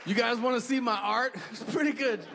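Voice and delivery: high, hammed-up German accent throughout; mock German accent. Hitler bragging about his art to the crowd, hammed-up German accent. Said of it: "Cool accent, though".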